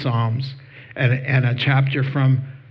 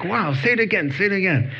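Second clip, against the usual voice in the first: higher, eager, asking for more; excited; 6 semitones higher, faster than usual. Retold or imitated. Mike in the grocery aisle, thrilled by the verse. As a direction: beg to hear it once more. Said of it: "he's all excited".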